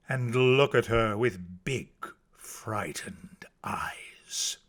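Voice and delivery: slow narration fading to whisper; hushed suspense. A narrator's reading. Narrator describing shocked onlookers, hushed and slow. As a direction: let it sink into a slow, very soft hush.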